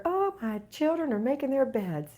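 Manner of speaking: high-pitched proud gushing; raving. Pretend. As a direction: rave like a boastful parent to the neighbors. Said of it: "you can just rave about 'em".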